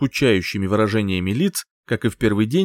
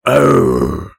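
loud pained yelp; 4 semitones lower, 7 dB louder than usual. Acted. Dragon-king's cry.

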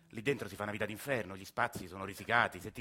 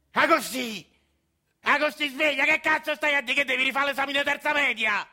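much higher, much louder furious yell; angry shout; 14 semitones higher, 11 dB louder than usual. Acted. Grandfather yelling at dreaming Agostino, loud furious shout. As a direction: bark him awake, fed up and furious.

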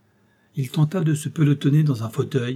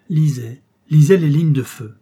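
louder on the repeated lisait; rising urgency; 2 semitones higher, 7 dB louder than usual. A narrator's reading. Frightened general reading.

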